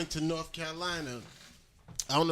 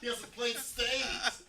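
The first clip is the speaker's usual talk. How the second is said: mock dismissive voice; 10 semitones higher, slower than usual.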